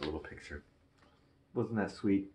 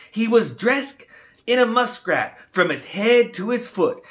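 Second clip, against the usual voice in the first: put-on higher, louder Cajun storyteller voice for the kids; Cajun story reading; 13 semitones higher, 9 dB louder than usual. Reading a Cajun Christmas book to kids, higher, louder Cajun storyteller voice. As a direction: spin the Cajun tale with lively bayou flair.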